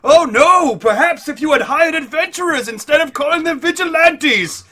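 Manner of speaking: very loud, high proclamation; hammed-up public outcry; Tiberius. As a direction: announce it to the whole square. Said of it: "Tiberius goes very loudly".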